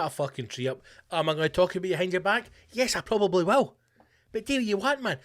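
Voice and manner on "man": ordinary talk, nothing marked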